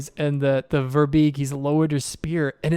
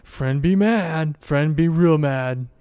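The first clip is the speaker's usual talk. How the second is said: slow, drawn-out simple-minded Verbeeg; worried simple monster; 5 dB louder, slower than usual. Acted. Verbeeg hears his friend roar, slow worried warning. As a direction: drag out the dread on each word. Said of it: "shakes his head and he goes".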